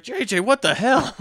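high, loud incredulous yell; shocked mock outburst. Shop manager confronting J J over the tape, shocked mock yell. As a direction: cry out in scandalised disbelief, half laughing.

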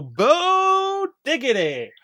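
slow, high hype introduction; hype announcement. Acted. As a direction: stretch the name out slowly like a ring intro. Hyping a supporter's name.

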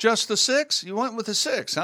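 high-pitched playful teasing. Real, not acted. Teasing a friend's sandwich order.

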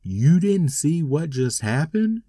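loud, slow exasperated question. Retold or imitated. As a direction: blurt it out too loud in disbelief. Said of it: "I did it probably louder than I should have".

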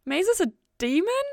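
very high, loud disbelieving question; very high loud incredulous rise. A narrator's reading. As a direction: squeak it out in shock. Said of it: "came out like a question".

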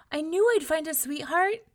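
high, loud, pleased exclamation; hopeful delight. A narrator's reading. Young man spots a cottage in the woods, delighted. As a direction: exclaim it with happy confidence.

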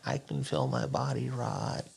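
low, soft, slow zombie groaning out words; half zombie. Retold or imitated. Friend quoting the half zombie from the film, low slow imitation. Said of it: "he remembered the half zombie".

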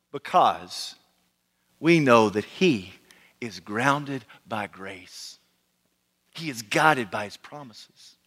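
slow, low and measured; solemn preaching. Real, not acted. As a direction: let each phrase settle in long pauses. Slow solemn repeat of key statement.

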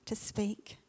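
whispered prayer word. Real, not acted. Pastor whispering a plea to the Holy Spirit. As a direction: breathe it out as a whisper.

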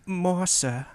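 timid, small orphan plea; Oliver Twist. Retold or imitated. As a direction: ask meekly like a starving orphan. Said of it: "the Oliver Twist scene where he's like".

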